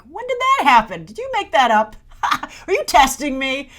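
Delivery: high, loud mock disbelief; incredulous. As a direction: act baffled, a bit accusing. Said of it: "And I honestly was like".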